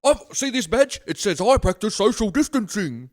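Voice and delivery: high, loud smug showing off; mock a proud rule-follower; high loud smug parody. Mocking smug badge-wearer.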